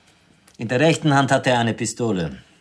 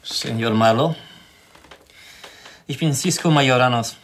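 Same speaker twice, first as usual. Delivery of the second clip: put-on lightly accented, polite stranger; a courteous Mexican stranger; Mexican visitor. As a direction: introduce yourself formally.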